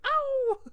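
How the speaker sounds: very high mock yelp, echoing the crowd. Imitating the fans echoing the scream, a very high yelp. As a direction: squeal the ow like the fans chanting back. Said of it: "the fans start going like".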